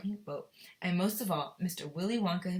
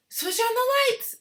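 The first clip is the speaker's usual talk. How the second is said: much higher, loud slow shout; shouted command; 11 dB louder, slower than usual. Acted. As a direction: call it out to the whole tunnel. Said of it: "shouted Mister Wonka".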